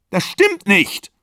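high, slow shouted denial; screamed denial. A narrator's reading. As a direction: scream it back at him. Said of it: "schrie sie".

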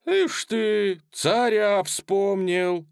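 high, loud mocking young soldier; young cossack. A narrator's reading. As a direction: scoff loudly from the crowd. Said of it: "сказал тот же молодой казак".

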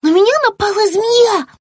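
very high, loud, breathless panic; frightened child. Acted. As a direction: blurt it out still scared. Frightened child reports attack.

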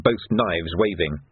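plain reading voice

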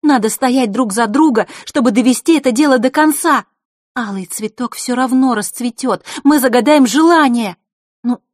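high, loud, passionate plea; heatedly. A narrator's reading. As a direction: urge him with fire. Girl keeps passionately urging him.

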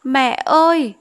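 the bride still wailing for her mother; grieving wail. Acted. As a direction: call out to mother in grief. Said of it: "vẫn gào thảm thiết".

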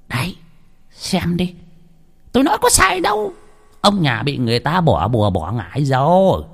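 a healer proving his point, a touch smug; I told you so, gravely; vindicated healer. A narrator's reading. Healer shows the blackened egg, a touch smug.

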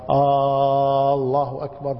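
آه held long and level before الله أكبر; faulty stretched opening. Shown as a demonstration. Teacher imitates ignorant callers, opening alef prolonged. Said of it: "الذي يقول مثل هذه الألفاظ".

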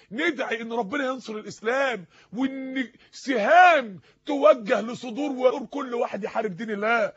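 rousing prayer call, key words drawn out and loud; forceful preaching. Real, not acted. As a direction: preach it hard, stretching the big words. (خطبة حماسية بنبرة قوية)

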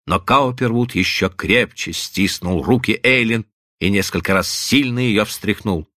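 ordinary delivery, nothing marked

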